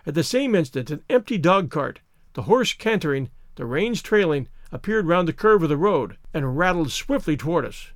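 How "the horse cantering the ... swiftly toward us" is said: usual speaking voice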